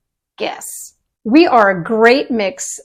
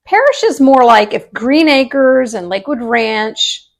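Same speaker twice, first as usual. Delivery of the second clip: ordinary talk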